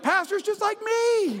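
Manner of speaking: high, drawn-out mock whine; imitated congregant. Congregant insisting the pastor is like them, mock exclaiming.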